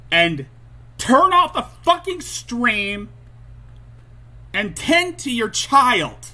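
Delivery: loud, high, punched-out exasperated rant; angry scolding. Real, not acted. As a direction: scold hard in short bursts, leaving pauses. Angry rant at clipped streamer.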